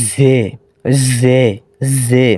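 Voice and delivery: জে said heavy and thick, like the Urdu letter with three dots; a full, heavy জে three times; thick Urdu zhe. Shown as a demonstration. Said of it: "একটু মোটা করে করতে হবে তথা".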